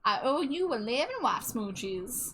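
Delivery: slow, playful Southern drawl. Pretend. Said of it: "it's Southern voice".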